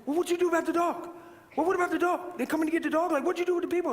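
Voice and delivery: high-pitched, urgent Southern questioning; imitated earnest Southern man. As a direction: mimic a worked-up maintenance man. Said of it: "He's so serious".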